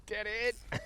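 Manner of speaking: high delighted cheer as the fish comes up; excited cheer. Real, not acted. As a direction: celebrate the catch, pitched high.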